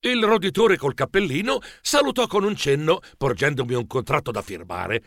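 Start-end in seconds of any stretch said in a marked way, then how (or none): none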